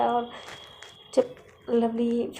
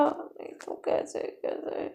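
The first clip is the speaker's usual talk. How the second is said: whispered aside; 13 semitones lower, 6 dB quieter, slower than usual. Retold or imitated. Muttering alone in bed.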